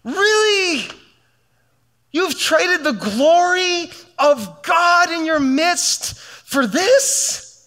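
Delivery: high, incredulous disbelief, voice climbing; Jesus exasperated. Retold or imitated. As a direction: voice Jesus as stunned and let down. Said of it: "He's like".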